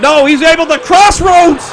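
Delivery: high, loud, drawn-out commentator calls; excited play-by-play. Acted. Commentator calling a near fall to viewers, loud and excited. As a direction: call the big moves like a hyped announcer.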